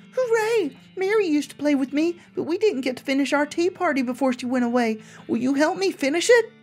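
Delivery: sweet and unsettling talking doll; too calm creepy doll. Acted. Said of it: "its mouth begins to move".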